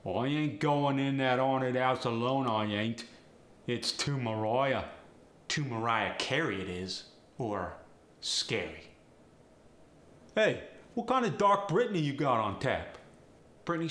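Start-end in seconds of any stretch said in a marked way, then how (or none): 0.1-4.9 cockney accent. Shown as a demonstration. Example sentence in Cockney slang. 10.4-13.0 higher, louder cockney pub order; cockney bloke at the bar; cockney accent. Shown as a demonstration. Example sentence in Cockney slang.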